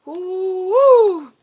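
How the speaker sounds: very high, rising whoop; excited whoop. Real, not acted. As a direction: let out a thrilled woo. Amazed at card.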